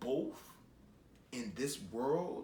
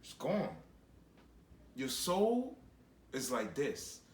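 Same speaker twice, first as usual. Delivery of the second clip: ordinary delivery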